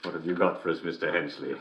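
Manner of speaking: ordinary talk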